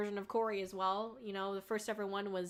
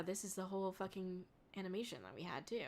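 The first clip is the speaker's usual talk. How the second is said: whisper; 4 semitones lower, 11 dB quieter, faster than usual. Real, not acted. Muttering aside while looking.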